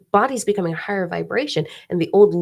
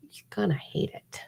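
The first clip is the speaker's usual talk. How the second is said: whispered warning; 9 dB quieter than usual. Real, not acted. Warning about pity party.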